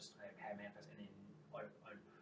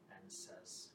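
normal talking voice

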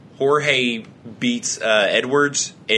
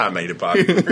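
laughing; 9 semitones higher than usual. Real, not acted. Joking reply amid laughter.

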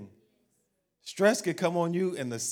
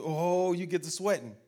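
usual speaking voice

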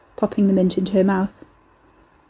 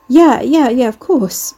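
stuttering; 4 semitones higher, 6 dB louder, slower than usual. Acted. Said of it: "Pauline stuttered".

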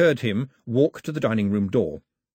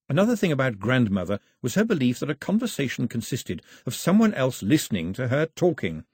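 usual speaking voice

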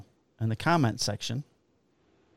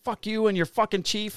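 mock angry yell; 9 semitones higher than usual. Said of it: "he's chasing me out".

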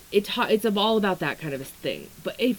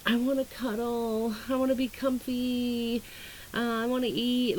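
higher, softer, cutesy whine; cozy whiny voice; 4 semitones higher, 5 dB quieter than usual. Pretend. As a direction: say it like a sleepy cat wanting comfort. Voicing cozy Taurus mood.

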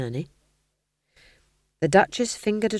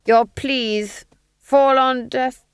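high, slow, loud declamation breaking off; drawn-out villain speech cut short; pompous Duke faltering; 7 semitones higher, 6 dB louder, much slower than usual. A narrator's reading. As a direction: proclaim grandly, then lose the thread. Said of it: "The Duke's voice trailed off".

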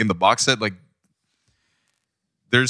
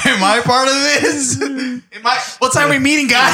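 laughing awkward left-out voice; 14 semitones higher than usual. Retold or imitated. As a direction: act left out while laughing. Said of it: "You just stand there like".

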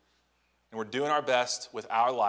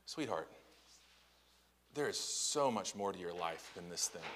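put-on softer and lower, tender comfort to a hurting teen; gentle reassurance; 5 semitones lower, 12 dB quieter than usual. Parent gently comforting a heartbroken teenager, soft and tender. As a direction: soothe a hurting young person quietly. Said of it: "One thing you might say is".

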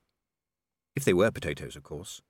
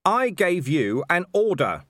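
slow, loud, pitched-up order; insistent command; 8 semitones higher, 5 dB louder, slower than usual. Acted. Commander to a sergeant who lowered the bow, insistent. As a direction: press each word on the sergeant.

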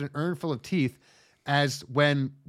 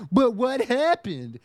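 high, slow, louder cutesy bewilderment; confused anime girl; 10 semitones higher, 6 dB louder, much slower than usual. Pretend. Cute anime girl baffled after tooth flies. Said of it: "It's like".

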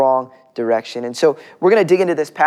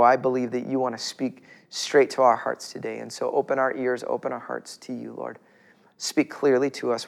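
soft, quiet closing prayer; 4 semitones lower, 4 dB quieter than usual. Real, not acted. Pastor praying softly for the church before the sermon. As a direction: pray gently over the congregation.

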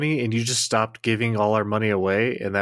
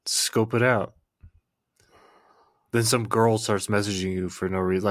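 ordinary delivery

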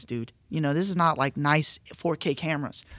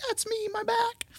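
"That's me, my back" in a much higher, strained imitation of a crying woman; mock crying woman; 4 dB quieter than usual. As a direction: squeak it out like the sobbing lady in the back. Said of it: "starts screaming, crying".

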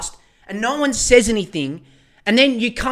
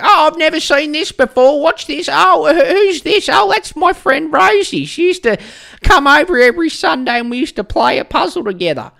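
put-on much higher and louder, amazed old lady; doddering old grandma; 8 semitones higher, 6 dB louder than usual. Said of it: "they'll be like".

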